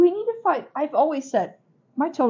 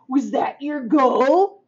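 slow teasing question; 2 semitones higher, 5 dB louder, much slower than usual. Retold or imitated. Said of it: "he's like".

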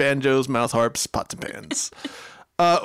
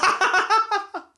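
high-pitched burst of laughter. Real, not acted. Laughing at the lyric.

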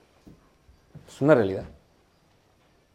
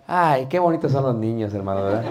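suddenly soft, lowered, doting tone; mock-tender preacher; 3 semitones lower, 4 dB quieter than usual. Preacher now admiring children, his own son loose, mock-tender. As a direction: coo it sweetly, sinking in pitch. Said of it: "Y dice el predicador".

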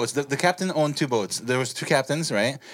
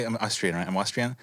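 low, soft attempt at an Austrian accent; lightly put-on Austrian accent; tentative Austrian impression; Arnold; 6 semitones lower, 6 dB quieter than usual. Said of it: "what's your Arnold impression".

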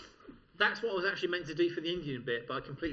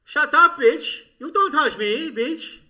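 loud, high mock Indian accent; indignant, hammed-up Indian accent; 10 semitones higher, 14 dB louder, slower than usual. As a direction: shout it in a put-on Indian accent.

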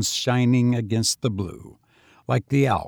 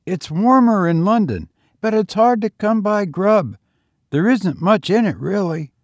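higher, youthful lad's voice; street boy; 8 semitones higher, 5 dB louder than usual. A narrator's reading. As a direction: play the lonely young tramp, higher. Boy's voice.

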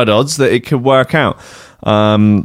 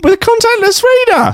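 surprised. Real, not acted. Disbelief at the street seller.

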